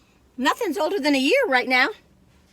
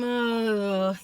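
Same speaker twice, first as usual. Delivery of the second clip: mock groan. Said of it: "If you sit there and going".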